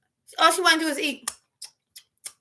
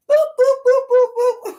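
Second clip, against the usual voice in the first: high, loud beeping noises; mock phone dial tones; phone dialing beeps; 10 semitones higher, 6 dB louder than usual. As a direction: beep out the phone number.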